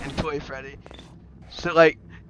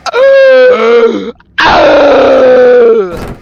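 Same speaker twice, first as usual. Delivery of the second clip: very loud, high, drawn-out scream; hammed-up howl of agony; acted scream; 7 semitones higher, 15 dB louder than usual. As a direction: scream long and loud as if blasted. Character blasted, loses body parts.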